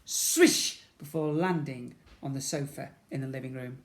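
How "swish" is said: high whispered swish; whooshing swish. Acted. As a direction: breathe out the swish. Sound effect.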